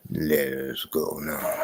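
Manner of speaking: very low put-on voice; deepest voice. Joking with friends on chat, put-on deep voice. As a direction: drop to an exaggerated deep rumble. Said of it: "with the deepest voice".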